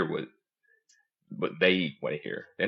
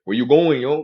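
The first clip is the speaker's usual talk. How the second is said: put-on loud, higher Spanish-neighborhood accent; imitated Spanish dude; 7 semitones higher, 11 dB louder than usual. Spanish dude asking where he's headed, imitated Spanish-neighborhood accent. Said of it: "if I get around a Spanish dude".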